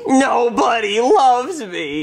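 slow whiny mock sob. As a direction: wail it like a sulky child. Cop sulks over birthday.

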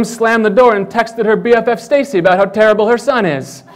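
ordinary talk

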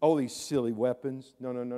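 ordinary talk

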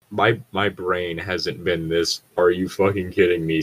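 ordinary delivery

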